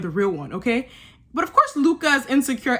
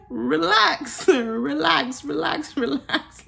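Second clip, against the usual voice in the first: laughing mock plea; 4 semitones higher, much slower than usual. Urging Ekinsu to calm down.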